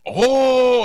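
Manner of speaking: high, loud drawn-out oh; sudden realisation. Retold or imitated. Driver finally grasping the missing food, loud realisation. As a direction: imitate it finally dawning, played big.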